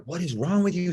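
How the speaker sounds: high-pitched exasperated retold yell; mock yelling. Yelling at the TV about a mean contestant, mock yelling. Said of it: "I was, like, yelling at my T V".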